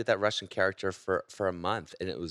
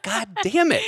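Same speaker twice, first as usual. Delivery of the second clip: high, loud cry of frustration; comic high-pitched exasperated yell; exasperated outburst; 10 dB louder, slower than usual. Retold or imitated. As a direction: shout it in mock despair. Retold exasperated reaction to friend.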